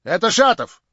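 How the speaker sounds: high, loud challenge; cried out. A narrator's reading. Hostess demands why Shatov stood up, crying out loudly. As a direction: call him out across the room. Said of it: "крикнула хозяйка".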